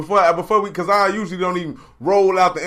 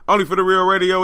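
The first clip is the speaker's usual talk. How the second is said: normal talking voice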